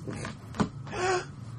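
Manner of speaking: high breathless mock gasp; sharp gasp. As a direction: gasp in fake shock. Acted gasp in retold scene.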